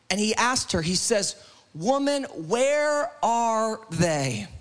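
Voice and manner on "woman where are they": drawn out word by word; slow, deliberate question. Acted. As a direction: stretch each word with weight. Slowly acts out Jesus's question.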